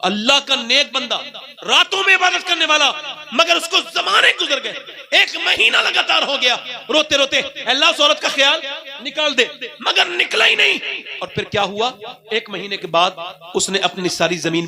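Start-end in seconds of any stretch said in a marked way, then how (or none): 1.7-10.8 rising emotional preaching. Acted. Preacher tells tearful pleading story.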